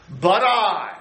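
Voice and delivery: high, slow, every "I" weighted; voice of God. Acted. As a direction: speak as the Almighty himself. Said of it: "the I is emphatic".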